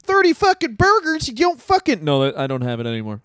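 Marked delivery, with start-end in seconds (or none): 0.0-2.0 high, angry swearing rant; Jim Cornette swearing tirade. Retold or imitated. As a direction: rage about the burgers. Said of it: "for a second I had it".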